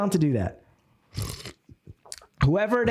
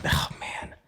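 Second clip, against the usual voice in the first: breathy aside; 6 dB quieter than usual. Real, not acted. Delighted aside to audience.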